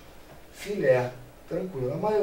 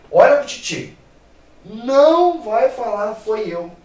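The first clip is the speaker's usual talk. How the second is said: playful warning; 6 semitones higher, 10 dB louder than usual. Shown as a demonstration. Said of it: "teacher warns students".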